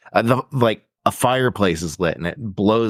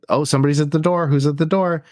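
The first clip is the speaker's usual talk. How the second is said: higher, mock-surprised voice; hammed-up puzzled call at the door; mock character voice; 6 semitones higher, faster than usual. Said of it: "And then it's like".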